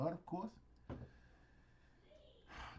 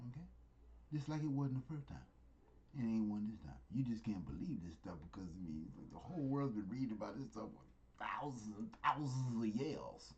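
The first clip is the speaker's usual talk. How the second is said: ordinary talk, nothing marked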